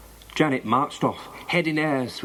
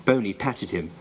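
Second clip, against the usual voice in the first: ordinary delivery, nothing marked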